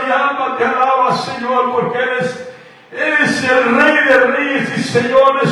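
normal talking voice

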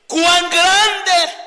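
loud, high awe-struck cry; admiring exclamation. Shown as a demonstration. Preacher showing the church the admiration mark, loud and high. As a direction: cry it out in wonder.

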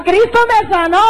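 high cry of "Cristo me sanó"; high retold shout of joy. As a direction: call it out high like a healed woman. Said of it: "Otra gritaba".

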